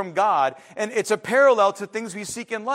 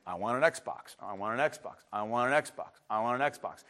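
low, monotone repeated demand; dogged kid's chant, pitched low and softer; nagging child; 8 semitones lower, 7 dB quieter than usual. Acted. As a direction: drone it on like a kid who keeps asking. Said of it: "and then you ask again".

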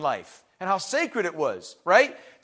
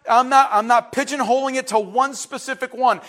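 normal talking voice

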